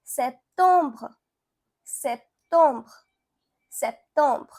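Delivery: septembre as "sep-tombr", nasal second vowel; French pronunciation. Shown as a demonstration. Teacher showing learners September in French, careful French septembre. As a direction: repeat septembre, dwelling on the second syllable. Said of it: "You can say".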